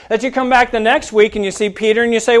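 ordinary talk, nothing marked

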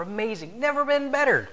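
high, gushing fake enthusiasm; over-the-top cheerful. Driver lying to the officer, gushing high. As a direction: oversell how great you feel to the cop. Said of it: "You can either say".

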